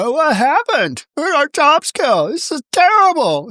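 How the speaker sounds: high, whiny mock lament; hammed-up high distress voice; mock whining. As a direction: whine like someone who lost their job. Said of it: "they're crying on somebody's shoulder".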